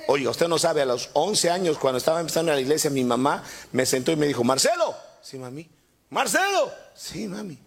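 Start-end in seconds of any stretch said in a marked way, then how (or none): 4.4-4.9 lightly voiced mother calling high. Mother calls young Marcelo to sit and listen. 5.2-5.7 put-on much softer, timid little boy; meek reply. Boy Marcelo answers his mother, very soft and meek. 6.1-6.7 lightly voiced mother calling high. Mother calls young Marcelo to sit and listen. 6.9-7.6 put-on much softer, timid little boy; meek reply. Boy Marcelo answers his mother, very soft and meek.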